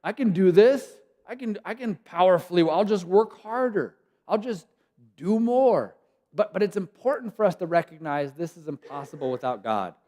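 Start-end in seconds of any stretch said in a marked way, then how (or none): 0.0-6.0 louder, higher can-do insistence; mock overconfident striver; self-reliant bluster. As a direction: puff up like you can handle it alone. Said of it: "as humans, it's like".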